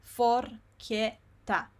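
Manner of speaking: very slow, separated syllables. Shown as a demonstration. Teacher shows long words little by little, very slow. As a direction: break each word into syllables with pauses. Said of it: "you go little by little".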